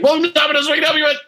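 high, bright customer service greeting; chirpy rushed phone greeting; sweet polite phone voice. Retold or imitated. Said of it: "just his voice on the phone was like".

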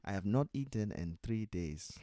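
hammed-up African-accented creature. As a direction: plead as a hungry little man-eater. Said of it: "when I'm playing a character, you'll hear the accent".

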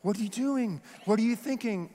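badly faked Scottish; poor Scottish accent. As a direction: have a go at a Scottish accent, scolding lightly. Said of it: "I know it's a poor Scottish accent".

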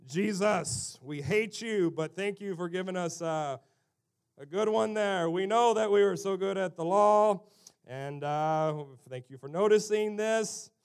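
loud, mock-pompous self-congratulation; smug Pharisees. Pharisees thanking Jesus for praising them, smug. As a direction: gloat as a hammed-up holy man. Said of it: "over in the corner are the Pharisees going".

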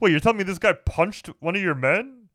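mock boss voice. Mocking the general.

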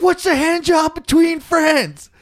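high, slow, whiny plea; hammed-up wheedling friend; whiny pleading friend. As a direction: plead like a wounded buddy. Kyle voiced pleading.

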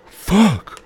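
sharp high curse; loud curse. Real, not acted. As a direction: blurt it out loud. Sudden outburst.

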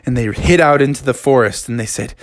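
ordinary delivery, nothing marked

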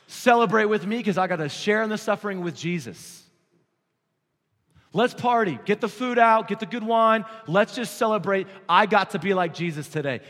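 loud, jubilant call to celebrate; exultant apostles shouting to the church to celebrate; joyful party shout. Acted. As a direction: throw a party for the whole room, loudly. Apostles celebrating after beating.

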